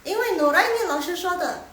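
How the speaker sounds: high, loud playful blaming; mock student's excuse. Students blaming their teacher for head-wagging, loud mock excuse. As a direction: pipe up loudly, passing the blame. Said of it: "Nanti kalian bilang".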